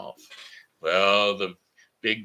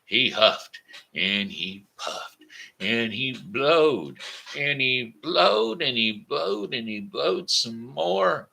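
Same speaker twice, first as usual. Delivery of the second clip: slow, rising, drawn-out telling; straining narration; 6 semitones higher, 4 dB louder, slower than usual. A narrator's reading. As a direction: stretch it out as he blows harder. Narrating wolf's long blowing.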